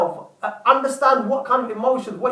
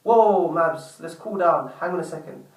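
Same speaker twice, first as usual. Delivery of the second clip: retold inner voice, lower and settling; calming self-talk; 6 semitones lower than usual. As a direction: talk yourself down under your breath. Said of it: "take a step back and think to myself".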